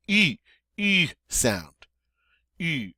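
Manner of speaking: "U" as long "ee" through rounded lips; French U. Shown as a demonstration. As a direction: pucker up and say "ee" for "U". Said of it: "long E sound in English through rounded lips".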